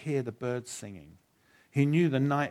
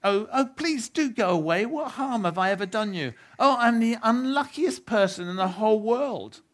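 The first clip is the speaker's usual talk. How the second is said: high, loud, trembling-fear plea to the dark; frightened pleading; 12 semitones higher, 8 dB louder than usual. Acted. Shasta pleads with an unseen thing in the dark, frightened and high. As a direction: beg the unseen creature to go away, terrified.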